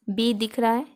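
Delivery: ordinary talk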